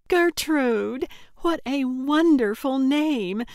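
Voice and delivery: high, loud, gushing delight. A narrator's reading. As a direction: squeal with delight at the name. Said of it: "a delighted little double clap".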